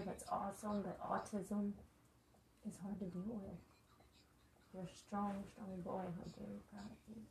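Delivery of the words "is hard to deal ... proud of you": hushed, tender voice; soft heartfelt encouragement. Real, not acted. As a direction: speak softly to comfort him. Emotional mother encouraging her son.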